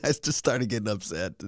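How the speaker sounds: ordinary delivery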